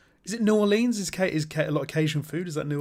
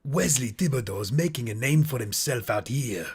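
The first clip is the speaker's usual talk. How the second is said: Cajun accent that drifts toward Puss in Boots. Shown as a demonstration. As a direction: repeats the line in a Cajun accent. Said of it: "I'm just getting Puss in Boots".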